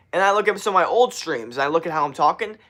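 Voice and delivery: normal talking voice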